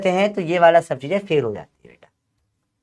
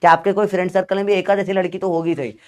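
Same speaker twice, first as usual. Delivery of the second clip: ordinary delivery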